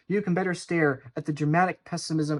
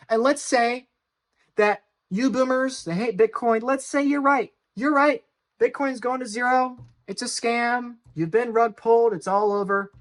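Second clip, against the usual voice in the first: raised, higher-pitched sarcastic agreement; mock concession; 6 semitones higher, 4 dB louder than usual. Video host granting that Bitcoin-hating boomers are right, mock conceding. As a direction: humour the doubters, pitched up and emphatic.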